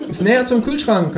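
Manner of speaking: high, smug kangaroo voice; cheeky kangaroo. A narrator's reading. Känguru figure voiced high.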